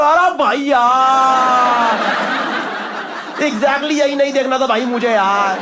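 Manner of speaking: drawn-out horrified yell; shocked outcry. Retold or imitated. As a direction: recoil at the sight. Shocked on entering delivery room.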